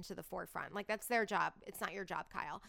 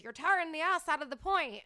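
a quick, hammed-up Irish accent, higher and louder; badly faked Irish brogue quoting a coach; terrible Irish accent; 6 semitones higher, 6 dB louder than usual. Quoting her Irish coach in a mock Irish accent. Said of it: "I just did a terrible Irish accent".

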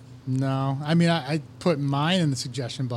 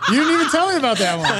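higher, louder through laughter; laughing protest; 8 semitones higher, 5 dB louder, faster than usual. Real, not acted. Hearing the rejected show name, loud laughing protest. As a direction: protest while cracking up.

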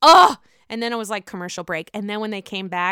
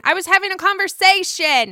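high, loud imitation of her insisting; loudly mimicked protest, pitched way up; mock protest; 11 semitones higher, 10 dB louder than usual. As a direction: act out her indignant defence. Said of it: "because Jennifer is saying".

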